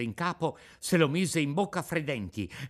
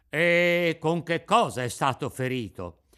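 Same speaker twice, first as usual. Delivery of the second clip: louder, demanding officer; stern interrogation; 3 semitones higher, 6 dB louder than usual. A narrator's reading. The carabiniere interrogates Pinocchio, stern. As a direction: press the boy for the culprit.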